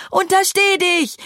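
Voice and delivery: very high, startled warning; alarmed shout. A narrator's reading. Lille warns Fee not to dare, alarmed shout. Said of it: "rief Lille erschrocken zurück".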